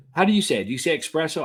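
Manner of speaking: ordinary talk, nothing marked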